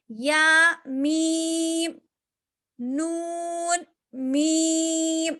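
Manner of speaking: نون and میم hummed long through the nose; nasalized ghunna. Shown as a demonstration. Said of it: "غنہ کر کے پڑھیں گے ہم".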